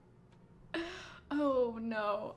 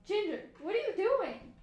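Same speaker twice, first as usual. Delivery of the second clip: higher, exasperated pet-owner call; talking to the cat; 6 semitones higher than usual. Real, not acted. Streamer to her scratching cat, exasperated. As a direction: question the misbehaving cat in a raised pitch.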